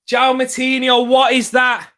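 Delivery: loud football commentator's complaint; exasperated shout. Real, not acted. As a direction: shout at the player in disbelief. Exclaims at a player's poor effort.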